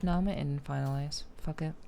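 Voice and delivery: normal talking voice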